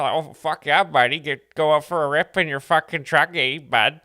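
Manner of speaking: loud, exaggerated Canadian accent; poor Canadian accent. Comic imitating poor Canadians, loud Canadian accent. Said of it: "That's the Canadian".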